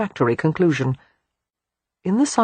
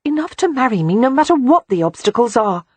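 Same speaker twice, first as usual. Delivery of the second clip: high and louder, pleading; begging; 8 semitones higher, 5 dB louder than usual. A narrator's reading. Beatrix pleading with Christopher to love her more, begging loudly. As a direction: beg him with everything you have. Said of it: "she begged".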